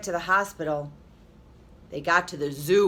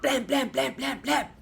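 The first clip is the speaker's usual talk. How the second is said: high, chanted knocking; teacher leading kids in a knocking chant; knocking sound; 6 semitones higher than usual. Shown as a demonstration. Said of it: "Do it with me".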